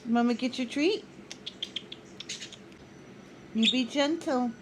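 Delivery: ordinary talk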